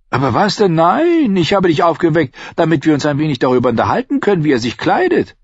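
high, loud mocking tirade; exasperated sarcasm. A narrator's reading. Tyrion to his confused squire, high exasperated sarcasm. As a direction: pile on the sarcasm, pitched well up. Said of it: "der Sarkasmus war vergebliche Liebesmüh".

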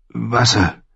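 leicht gekrächzte, höhere Bitte; krächzend. A narrator's reading. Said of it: "krächzte er". (krächzend, mit trockener Kehle)

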